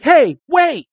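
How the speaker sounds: high, calling after him. Retold or imitated. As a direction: call it out high. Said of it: "he's like".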